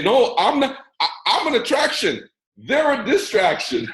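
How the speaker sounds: high-pitched mock indignant denial; mock protest. Mimics a protesting listener pointing fingers.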